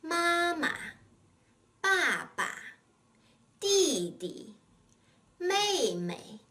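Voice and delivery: 妈妈, 爸爸, 弟弟, 妹妹 each with a long first syllable and a short, light second; teacher's careful model of 妈妈, 爸爸, 弟弟, 妹妹, second syllables unstressed; neutral-tone second syllable. Shown as a demonstration. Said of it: "Other similar examples are".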